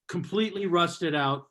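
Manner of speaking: ordinary talk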